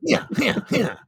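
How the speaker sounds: high falling hammy yeahs; breathy fake moans; mock moaning yeahs.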